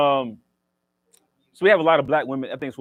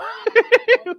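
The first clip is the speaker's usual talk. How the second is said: high giggle; 8 dB louder than usual. Real, not acted. Laughs at own joke.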